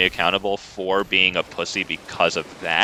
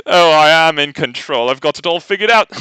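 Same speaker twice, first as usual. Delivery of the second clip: high, hammed-up posh British lord, chuckling; mock a smug British lord toying with his opponent; lordly British accent; 9 semitones higher than usual.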